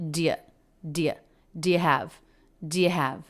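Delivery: "do" said so fast it has almost no vowel. Shown as a demonstration. Teacher showing how "do" nearly loses its vowel. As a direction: clip "do" down to just a d sound. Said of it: "almost doesn't have a vowel".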